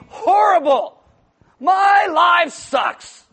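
high, loud, very drawn-out moan; hammed-up bitter Naomi; mock bitter wail. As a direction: groan out your misery to a friendly greeter. Naomi answering greeting bitterly.

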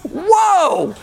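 high, slow, drawn-out whoa; stunned whoa; surprised whoa. Real, not acted. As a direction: let the disbelief stretch out. Reacting to absurd pile-on.

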